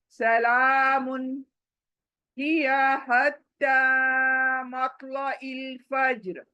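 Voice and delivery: slow, melodic tajweed recitation; Quranic recitation. Shown as a demonstration. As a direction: chant it the way it is recited.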